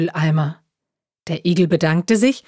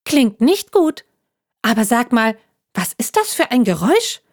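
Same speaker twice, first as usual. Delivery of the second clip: higher, chatty little talking juice box; chirpy juice pack; 5 semitones higher than usual. Acted. Juice pack explaining itself to the hedgehog, chirpy and higher. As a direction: bounce along like a cheerful carton.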